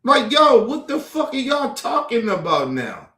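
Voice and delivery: normal talking voice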